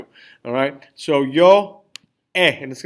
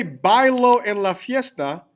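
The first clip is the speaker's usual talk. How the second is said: BAI-lo, high first syllable in Bailo, slowly; stress on first syllable; 4 semitones higher, 3 dB louder, slower than usual. Shown as a demonstration. Teacher asking class to translate the top sentence, Bailo stressed on BAI. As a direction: Bailo with an unaccented, falling final O. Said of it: "the top sentence".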